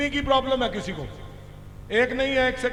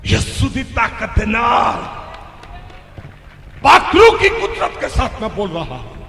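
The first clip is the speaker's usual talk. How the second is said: powerful preaching shout; 2 semitones lower, 5 dB louder than usual. Real, not acted. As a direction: proclaim it with full force to show his strength. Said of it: "पर मैं किस तरह बोलन दिया?". (ज़ोरदार ऊँची आवाज़ में ऐलान)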